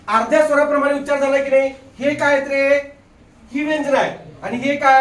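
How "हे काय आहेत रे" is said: ordinary delivery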